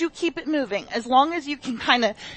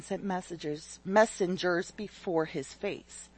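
lower, softer scripture reading; reading scripture aloud; 6 semitones lower, 6 dB quieter than usual. Acted. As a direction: read the passage gently to the congregation.

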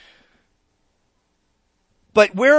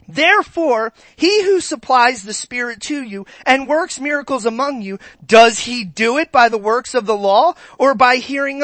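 higher, louder fervent reading; preaching scripture; 3 semitones higher, 4 dB louder than usual. Acted. Pastor reading Galatians to the congregation, fervent preaching. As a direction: proclaim the verses.

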